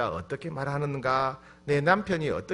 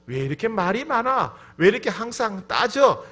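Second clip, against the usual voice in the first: higher, louder mock nagging; raised-voice grumble at a partner, imitated; exasperated complaint; 6 semitones higher, 5 dB louder than usual. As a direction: act out a fed-up spouse. Acted complaint once love's blindness lifts.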